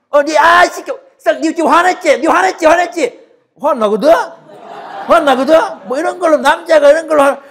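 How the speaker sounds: put-on loud, high-pitched childish taunting; squabbling young brothers. Young sons fighting over who got angry, loud mock squabble. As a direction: act out kids needling and hotly denying it. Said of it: "우리 아들들이 하는 짓입니다".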